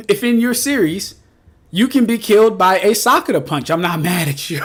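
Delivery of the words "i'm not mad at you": ordinary delivery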